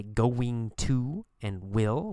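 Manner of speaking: "going to and will" slowly, every word complete; careful full forms. Shown as a demonstration. Teacher showing what normal speech avoids, full careful "going to and will". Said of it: "We change the pronunciation".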